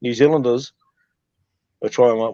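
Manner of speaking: normal talking voice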